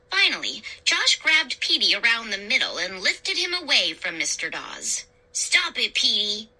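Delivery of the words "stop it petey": ordinary delivery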